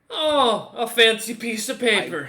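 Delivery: loud, high mock-impressed jeer; mocking guard. Guard shown a letter of backing, loud mockery. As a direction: scoff loudly, pitched well up. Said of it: "He looks up at you and goes".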